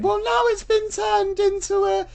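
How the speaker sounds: high put-on woman's voice, laughing; Wendy character voice. Wendy reporting the branch closure in high put-on voice. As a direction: keep it high and chirpy.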